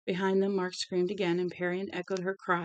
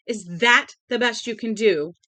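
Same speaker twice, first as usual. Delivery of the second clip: furious shrieking; 3 semitones higher, 10 dB louder than usual. A narrator's reading. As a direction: tear into him for his big talk. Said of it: "This time she shrieked it".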